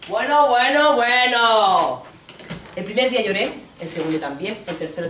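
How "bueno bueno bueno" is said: voz de colega que quita hierro, cada vez más fuerte; el amigo que lo justifica todo; colega indiferente. Retold or imitated. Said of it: "El colega dijo". (el colega restando importancia)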